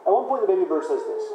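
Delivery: ordinary delivery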